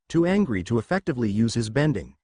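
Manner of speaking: plain reading voice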